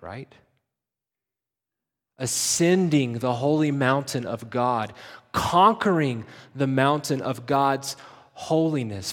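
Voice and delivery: ordinary delivery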